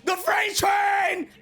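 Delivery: hype shout. Real, not acted. Hyping Garp's incoming attack.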